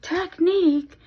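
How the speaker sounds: "Technique" squealed high and loud like SpongeBob. Retold or imitated. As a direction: shriek "Technique" in a cartoon voice. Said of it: "where he's like".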